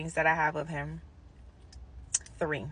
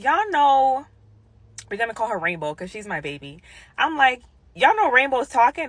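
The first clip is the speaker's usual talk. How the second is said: excited news; 6 semitones higher, 5 dB louder than usual. Retold or imitated. Telling other nurses excitedly.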